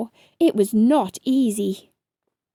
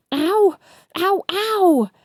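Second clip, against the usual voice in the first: higher, louder yelps; exclaimed ows; 8 semitones higher, 5 dB louder, slower than usual. A narrator's reading. Both bitten again, loud yelps. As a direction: yelp them out. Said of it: "exclaimed together again".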